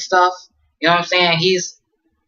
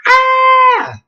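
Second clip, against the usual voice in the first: high, loud sustained scream; imitated crowd scream; 7 dB louder than usual. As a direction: let out a long high-pitched panicked shriek. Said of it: "They just start screaming".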